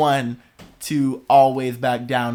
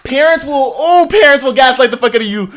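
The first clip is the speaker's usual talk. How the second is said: heated rant; 10 semitones higher, 10 dB louder than usual. Real, not acted. Heated rant about parents.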